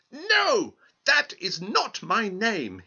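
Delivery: very high yelp of no, then slow; impatient little man. Acted. Little man refusing the queen, high impatient yelp. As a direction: snap out the no, then drag it. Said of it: "he replied".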